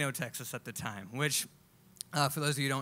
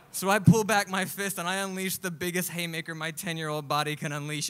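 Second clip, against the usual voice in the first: ordinary delivery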